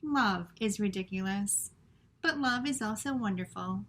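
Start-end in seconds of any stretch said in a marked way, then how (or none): none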